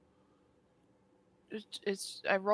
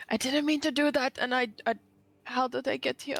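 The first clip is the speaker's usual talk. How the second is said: whisper yelling; 6 semitones higher than usual. Acted. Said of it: "I'm gonna whisper yell".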